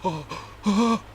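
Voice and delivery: rising mock gasps of dread; slow horror. Fighter turning slowly to his wife, horrified gasps. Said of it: "this slow look of horror".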